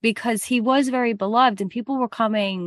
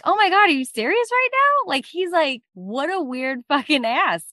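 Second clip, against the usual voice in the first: shocked king; 7 semitones higher than usual. Acted.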